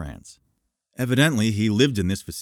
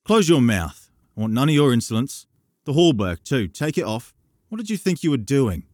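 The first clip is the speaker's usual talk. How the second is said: put-on louder, faster scolding of a cheeky boy; 7 semitones higher, 5 dB louder than usual. A narrator's reading. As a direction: snap at him to shut up.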